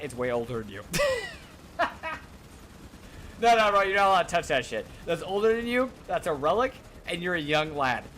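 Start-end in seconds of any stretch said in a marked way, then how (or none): 0.9-3.3 squeaky breathless laugh; high cackle. Real, not acted. Streamer cracking up at his own joke, high giggle. 3.4-8.0 higher, louder teasing lecture; mock scolding. Streamer teasing a young viewer about the relic pistol, mock scolding.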